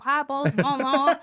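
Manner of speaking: put-on loud, drawly Southern accent; hammed-up Southern accent. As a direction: put on a broad Southern twang. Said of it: "'cause in a Southern accent".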